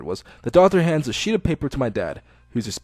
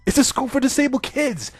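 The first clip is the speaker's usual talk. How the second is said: higher indignant protest; 10 semitones higher than usual. Acted. Hisao protesting to the doctor, higher and indignant. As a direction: push back, offended.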